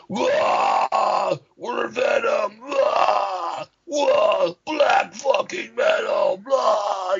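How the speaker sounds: high, drawn-out mock metal roar. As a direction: imitate a metal frontman shouting his stage intro. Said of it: "he'd just be like".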